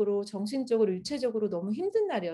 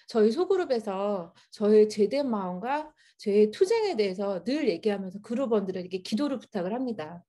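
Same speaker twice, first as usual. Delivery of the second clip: usual speaking voice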